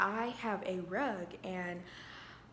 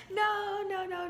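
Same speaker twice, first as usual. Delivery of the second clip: high, loud "no, no, no" in mock alarm; emphatic refusal; 12 semitones higher, 6 dB louder, slower than usual. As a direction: shoot it down fast and high. Refusing the man's question about kids.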